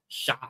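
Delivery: "Sha" punched as the stressed opening syllable; first-syllable stress. Shown as a demonstration. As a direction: hit "Sha" firmly, then stop. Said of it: "put the emphasis on the first syllable".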